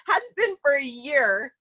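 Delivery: slow, laughing through tears; teary laugh. Real, not acted. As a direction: let the emotion break through slowly. Moved retelling, laughing through tears.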